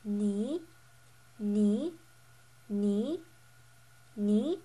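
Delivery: "ni" slanting upwards; second tone. Shown as a demonstration. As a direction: glide "ni" up in pitch like a question. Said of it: "the slanted upwards tone".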